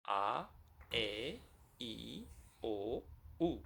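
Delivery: A, E, I, O, U softly held one by one; A, E, I, O, U soft and separated; isolated vowel sounds. Shown as a demonstration. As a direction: say A, E, I, O, U as pure vowels, ah, eh, ee, oh, oo. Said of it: "That is vowels".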